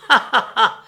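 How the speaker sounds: high, loud frenzied mocking laughter; scornful laugh. A narrator's reading. Little man laughing at the tall stranger, scornful frenzy. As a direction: laugh him down with contempt. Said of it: "en riant dédaigneusement".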